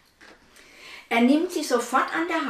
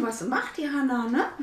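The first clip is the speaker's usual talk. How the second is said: ordinary delivery, nothing marked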